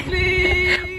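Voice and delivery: fake begging. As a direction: overdo a jealous plea. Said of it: "नकली acting ना करो आप".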